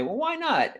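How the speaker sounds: high-pitched comic objection; protesting bird cages. Acted. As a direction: pipe up high in mock protest.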